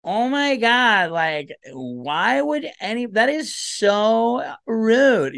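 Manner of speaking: put-on high, slow, scandalized valley girl; mock-gasp at rudeness, drawn out and high; offended woman. Woman president acting offended, high and scandalized. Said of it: "She's like".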